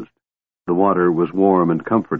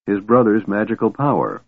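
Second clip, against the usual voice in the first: plain reading voice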